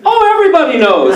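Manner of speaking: high, fast, laughing; tossed off like it is no big deal; cheery dad. Retold or imitated. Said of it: "my dad over the phone goes".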